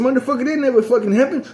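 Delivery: high-pitched, heated retelling of his own words; retold outburst. Retold quote snapping at guy.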